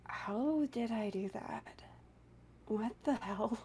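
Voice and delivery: hushed, breathy disbelief at herself; soft awed whisper to herself; stunned whisper. Retold or imitated. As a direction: whisper it like you can't believe what you just did. Retelling own stunned reaction.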